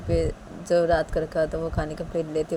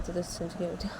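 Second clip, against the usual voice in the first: ordinary delivery, nothing marked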